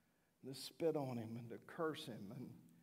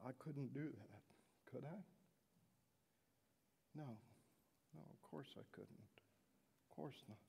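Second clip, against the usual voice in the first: resigned; 11 dB quieter than usual. Acted. Governor justifying himself, resigned.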